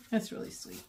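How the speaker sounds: whispered, barely voiced; touched whisper. Real, not acted. After reading the note, whispered to herself. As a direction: breathe it out to yourself. Repeated, moved.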